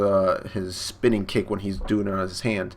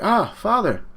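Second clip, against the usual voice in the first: high, loud mock alarm; imitated startled yelp; startled cry; 13 semitones higher, 5 dB louder, slower than usual. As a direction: act out kids caught by their father. Children startled by father.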